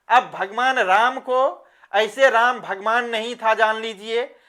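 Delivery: ordinary delivery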